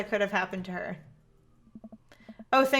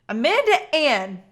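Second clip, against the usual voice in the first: slow, louder scolding call; stern full-name call; 4 semitones higher, 7 dB louder, slower than usual. Acted. Dad calls daughter walking to her room, stern.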